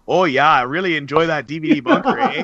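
mock up-north Canadian accent; jokey Canadian-style northern lilt. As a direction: do a playful far-northern voice. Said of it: "let's take it up north".

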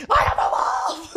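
hushed wolfish cry; dog's fierce whisper-shout. Pretend. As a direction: rasp it out like a proud wild wolf. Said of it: "Eugene just like".

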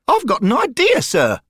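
put-on high piping boy's voice; high, loud treble. A narrator's reading. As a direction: pipe up eagerly like a keen lad. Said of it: "Derek piped up".

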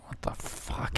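unvoiced stunned whisper. Real, not acted. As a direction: breathe it in disbelief. Aside reacting to clip.

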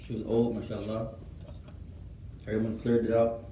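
ordinary talk, nothing marked

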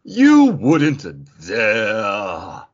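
very slow and loud, every word hammed up; dramatic reading. [name] reads a Sabrina panel aloud for the show, dramatically slow and loud. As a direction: drag each word out like a stage villain. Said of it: "a dramatic reading from Sabrina".

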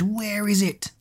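high, strange menacing voice; a sinister otherworldly demand; menacing eerie demand. Acted. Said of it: "menacing, hysterical scream".

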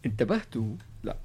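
ordinary talk, nothing marked

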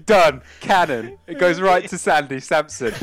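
loud, high, thrilled play-by-play; excited narration. Acted. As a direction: call it like a big moment.

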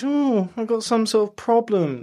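high mocking whine of a worried kid; whiny kid voice. Mocking the kids who turned orange.

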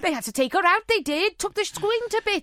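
high-pitched Welsh gossip; gossiping Welsh local. Acted. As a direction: chime in with a hammed-up Welsh accent.

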